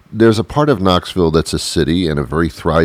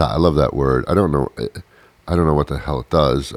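normal talking voice

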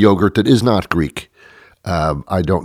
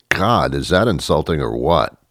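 ordinary delivery

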